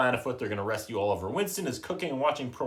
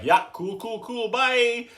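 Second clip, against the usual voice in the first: breezy dismissal; 10 semitones higher, 3 dB louder, much slower than usual. Retold or imitated. Speaker imagines dismissing dogs.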